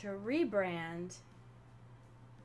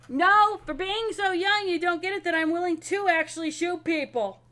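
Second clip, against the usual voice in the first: high, loud acted comeback; indignant high-pitched yelling in character; shouted retort; 8 semitones higher, 9 dB louder than usual. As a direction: fire back at full volume. Gwen's shouted retort in roleplay.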